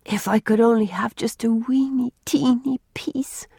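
put-on small, pleading girl's voice; wistful sigh. A narrator's reading. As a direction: sigh it out, drawing out the tiny words. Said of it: "she sighed".

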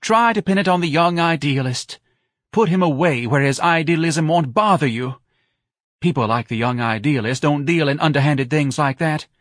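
high, loud, reproachful tirade; wounded wife. A narrator's reading. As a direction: scold him in a wailing voice. Wife accusing her husband.

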